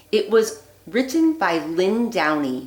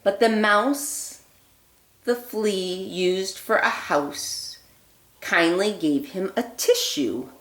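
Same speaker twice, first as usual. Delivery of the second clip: put-on lively read-aloud voice for children; storybook reading; 4 dB louder than usual. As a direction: read it like a bedtime story for little ones. Reads picture book aloud.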